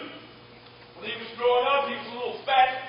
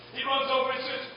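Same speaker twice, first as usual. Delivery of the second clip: ordinary talk, nothing marked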